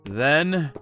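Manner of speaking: plain reading voice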